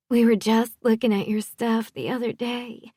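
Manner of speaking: high, eager society-wife voice; Southern wife. A narrator's reading. Wife telling the stylist they browsed her designs, gushing. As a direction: gush in a high Southern character voice.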